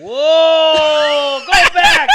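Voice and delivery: loud, high whoop of disbelief; excited shout. Real, not acted. Startled reaction to clip.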